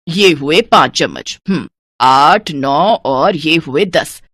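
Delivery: counts each spoon slowly; slow counting. Acted. (कंजूसी से धीरे-धीरे गिनती)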